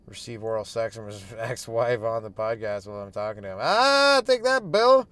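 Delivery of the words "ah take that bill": high, loud mock triumph; gloating cry. Comedian gloats at Bill Gates in a fantasy, loud and high. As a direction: crow over your beaten rival.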